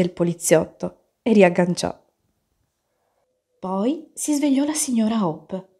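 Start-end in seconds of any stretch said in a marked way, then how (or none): none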